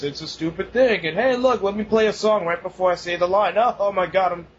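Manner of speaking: ordinary delivery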